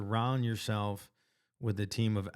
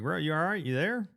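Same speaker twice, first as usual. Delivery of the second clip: higher, worried calling into the phone; retold worried bro asking if he's okay; concerned check-in; 7 semitones higher than usual. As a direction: friend went silent on the phone, so a concerned call. Checking on silent friend on phone.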